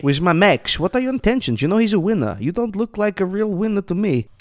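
high mock Borat accent; lightly hammed Borat voice; a hint of Borat interrogating a date. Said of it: "slipped into Borat there".

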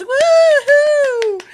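very high, loud whooping cheer; crowd cheer. Retold or imitated. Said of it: "everyone goes".

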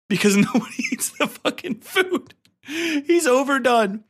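laughing as the voice jumps high and breaks; cracking up while explaining to viewers. Real, not acted. Cracking up at the film's joke, laughing through the words.

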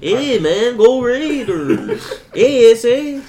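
high, loud, drawn-out mock-Mexican cheer; hammed-up Mexican accent, calling out with laughter. Joking as a Mexican Raiders fan, Mexican accent. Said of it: "I'm a Mexican".

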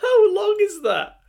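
high-pitched, loud disbelief; shocked. Real, not acted. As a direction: gasp out the question in shock. Shocked at the reading length.

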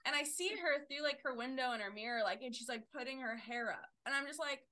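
usual speaking voice

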